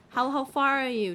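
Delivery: ordinary talk, nothing marked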